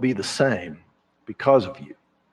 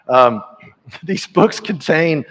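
slow, teary laugh before the words; real tearful laugh, slower; laughing through tears; 4 semitones higher, 5 dB louder than usual. Real, not acted. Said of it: "I'm trying not to cry".

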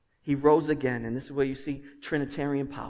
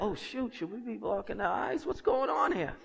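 high, hushed parental fretting; mock alarm; 7 semitones higher, 5 dB quieter than usual. Parent watching a creepy scene with the kids, mock alarm. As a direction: fret softly in a raised pitch. Parent's worry voiced in a story.

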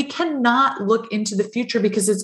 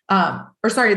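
normal talking voice

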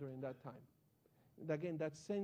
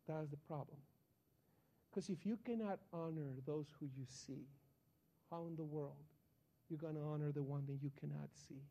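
grave pastor; 5 semitones lower, 5 dB quieter than usual. Retold or imitated. Pastor voiced, gentle rebuke.

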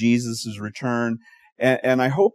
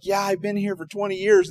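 high excited castaway; a hammed-up castaway pitched up high; 9 semitones higher than usual. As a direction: play the lonely castaway, pitched way up. Castaway voiced in joke.

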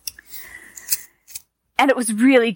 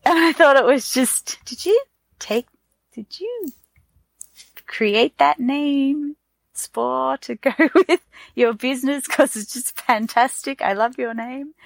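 gushing admiration; 5 semitones higher than usual. Retold or imitated. Gushing at the herbalist's name.

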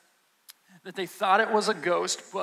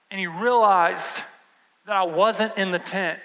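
ordinary talk

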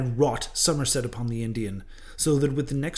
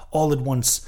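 usual speaking voice

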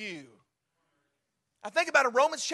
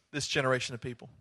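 soft God voice; 11 semitones lower, 8 dB quieter, faster than usual. Acted. God answers Reed.

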